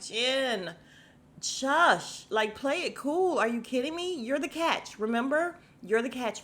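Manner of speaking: high, exasperated pep talk; playfully exasperated telling-off; mock scolding. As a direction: chide a friend for being too eager. Acted scolding of Jennifer at screen.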